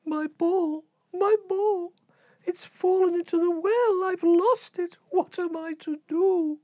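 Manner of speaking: put-on high, wailing little-girl lament; high tearful princess voice; crying princess. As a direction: wail it like a heartbroken child. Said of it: "She cried and she cried".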